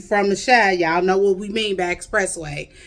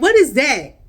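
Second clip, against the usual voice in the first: mildly mocking puzzled question, pitched up; baffled; 6 semitones higher, 4 dB louder than usual. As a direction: imitate someone thrown by an odd word. Mimics listeners baffled by word pop.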